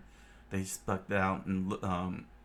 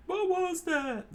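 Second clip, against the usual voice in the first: much higher, slow alarmed question; high startled chef; 4 dB louder, slower than usual. Acted. Chef reacting to the explosion, high and alarmed. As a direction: react to the blast.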